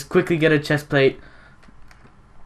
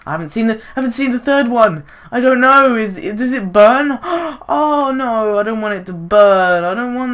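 rising, louder fretting over the burning town; mock panic; 8 semitones higher, 5 dB louder than usual. Gamer fretting over the burning town, mock panic. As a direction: play up the panic, voice climbing.

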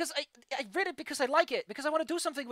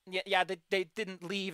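normal talking voice

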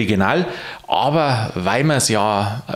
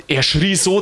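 ordinary delivery

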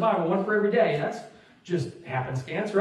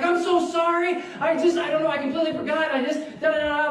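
flustered excuses; 8 semitones higher than usual. Retold or imitated. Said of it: "I'm making all these excuses".

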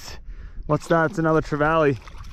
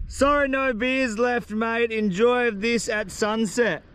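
usual speaking voice

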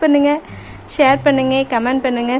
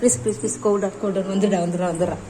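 soft coaxing; 4 semitones lower, 8 dB quieter than usual. Real, not acted. Soothing angry pet.

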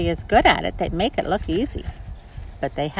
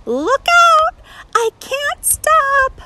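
put-on high, frantic little girl calling out; panicked girl voice; 5 dB louder, slower than usual. Sally Jane calling as she skates toward the pond. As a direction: yell it like a scared kid rolling out of control.

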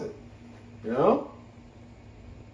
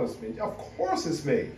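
normal talking voice

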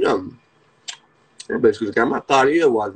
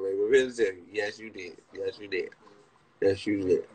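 ordinary delivery

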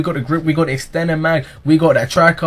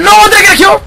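panicking gamer; 9 dB louder, much faster than usual. Real, not acted. Checking kill in panic.

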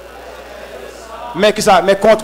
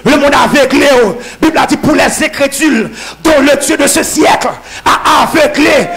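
loud, high, shouted sermon that keeps building to amens; fiery preaching; 7 semitones higher, 8 dB louder than usual. Acted. Preacher rousing the congregation, shouting loud and high. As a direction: preach it at full force to the congregation.

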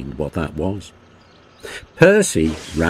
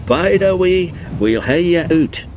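higher, louder Scottish engine voice; Scottish twin engines, urgent and reassuring; 9 semitones higher, 4 dB louder than usual. A narrator's reading. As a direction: call out in broad Scots to a stuck friend. Twins call to Henry.

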